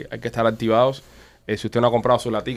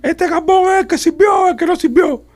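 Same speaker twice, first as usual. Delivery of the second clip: loud, high-pitched imitated yell; retold shout, pitched way up and drawn out; shouting across a store; 5 dB louder, much slower than usual. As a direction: holler it to a friend far down the aisle. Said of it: "alguien grita por allá".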